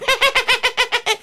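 squeaky high laugh; high mosquito cackle. Acted. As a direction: cackle high like a pesky bug.

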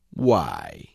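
curt caterpillar. A narrator's reading.

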